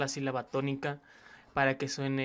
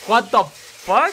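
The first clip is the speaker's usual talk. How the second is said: loud, slow, stunned outburst; drawn-out what-the-hell shout; shocked; 6 semitones higher, 13 dB louder, much slower than usual. Real, not acted. Reacting to the video, a loud slow stunned shout.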